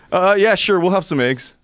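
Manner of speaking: high, over-eager people-pleasing yes; mock cheerful compliance; nervous agreeing.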